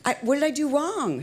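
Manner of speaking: high, pleading mock innocence; retold bewildered protest. Trainee to the storming director, high bewildered protest. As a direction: act out helpless confusion under scolding.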